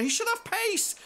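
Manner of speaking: high loud yell on pace; exasperated shout. Real, not acted. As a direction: shout it at the screen. Repeated complaint.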